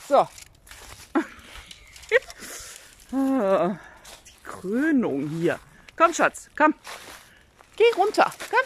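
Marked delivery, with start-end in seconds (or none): 3.1-5.6 very slow stretched Krönung; drawn-out groan. Real, not acted. Owner exasperated with the hens, slow drawn-out Krönung.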